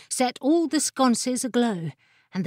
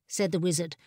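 usual speaking voice

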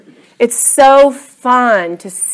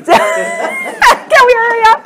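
high-pitched squeal through laughter; comically urgent, voice shooting up; mock urgent plea; 4 dB louder, slower than usual. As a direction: play a desperate plea, very high. Acting own urgent plea, laughing.

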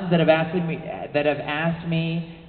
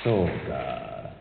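low, far-off alien frog voice; 8 semitones lower, 6 dB quieter, much slower than usual. Shown as a demonstration. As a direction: growl it out like a frog sergeant. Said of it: "a Sergeant Frog voice for me".

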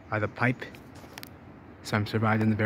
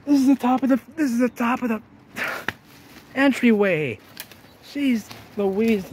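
much higher and louder, thrilled; excited discovery; 11 semitones higher, 5 dB louder than usual. Real, not acted. Explorer spotting a hidden entrance to viewers, thrilled and high. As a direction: blurt it out as the find hits you.

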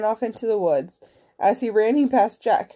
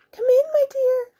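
very high fake granny voice; wolf posing as granny. A narrator's reading. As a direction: pitch it way up like a granny.

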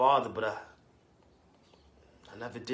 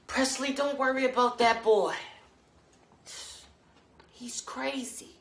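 placating mother; 11 semitones higher, slower than usual. Acted. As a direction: soothe him in a high voice, trailing off slowly.

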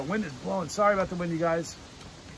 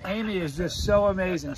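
ordinary talk

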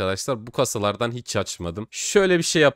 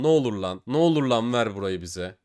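ordinary delivery

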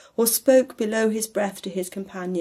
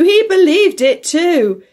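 high, loud and slow exclamation; loud cry; 9 semitones higher, 11 dB louder, slower than usual. A narrator's reading. Nephew exclaims to the party, loud and high. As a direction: call it out with amusement. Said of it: "cried Scrooge's nephew".

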